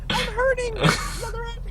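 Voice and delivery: very high, whiny imitation of a hurting rider; mock pained plea. Mimicking the girl begging on the ride, high pained squeal. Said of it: "they were in pain".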